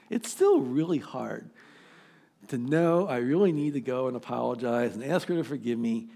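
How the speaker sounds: usual speaking voice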